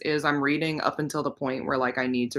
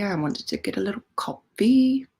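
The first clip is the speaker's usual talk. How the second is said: playful whisper; 6 semitones higher than usual. Pretend. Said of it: "Why am I talking in this accent?".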